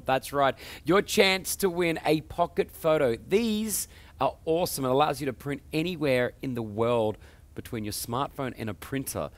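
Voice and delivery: high, loud show-opening delivery; punchy, energetic host intro hyping the show; upbeat TV presenter. Acted. Host welcomes viewers to the tech show, upbeat presenter.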